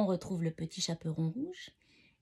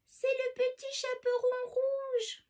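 very high, slow falsetto; wolf faking a little girl's voice; disguised voice; slower than usual. A narrator's reading. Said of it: "répond le loup en déguisant sa voix".

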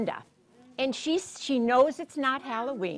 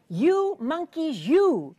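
drawn-out, raised scolding of naughty monkeys; scolding peddler; 6 semitones higher, 4 dB louder, slower than usual. A narrator's reading. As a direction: scold them slowly, pitch swooping up on YOU.

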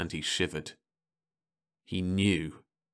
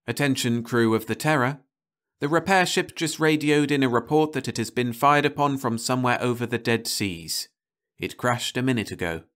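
loudspeaker announcement; 5 semitones higher than usual. Acted. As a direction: announce it over the speakers, matter-of-fact.

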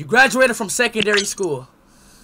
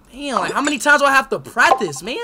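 frustrated rant; 8 semitones higher, 5 dB louder than usual. Real, not acted. Gamer frustrated at failing.

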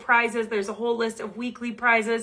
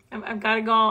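ordinary talk, nothing marked

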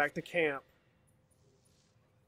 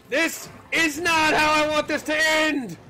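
drawn-out desperate wail; 13 semitones higher, 11 dB louder, slower than usual. Real, not acted. Repeats slower, higher.

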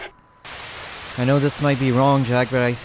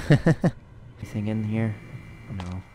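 usual speaking voice

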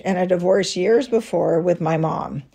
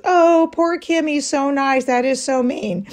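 pitying listeners; 5 semitones higher than usual. Retold or imitated. Everybody reacting to her refusing Kim, pitying. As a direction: imitate a crowd feeling sorry for him. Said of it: "when everybody says".

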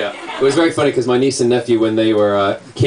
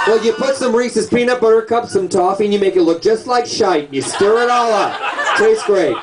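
high, animated retold recipe; comic mock instructions; 10 semitones higher than usual. Customer explaining the McFlurry to counter girl, high and animated. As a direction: rattle off the silly recipe, pitched up.

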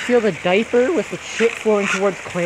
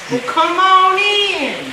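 teasing sing-song invite; 9 semitones higher, 5 dB louder, slower than usual. Acted. Coaxing friend into the water.

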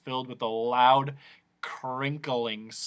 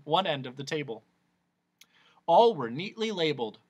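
usual speaking voice

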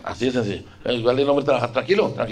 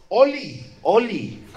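high, sing-song mock "Oli"; lightly mocking "Oli" chirp; mock cutesy greeting; 7 semitones higher, much slower than usual. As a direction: parody a girly online hello. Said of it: "no diga Oli".

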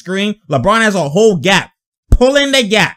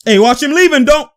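loud, higher-pitched courtside call; calling out a warning; 8 semitones higher, 4 dB louder than usual. Acted. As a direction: voice a player alerting a teammate, raised and urgent. Voicing player's warning to teammate.

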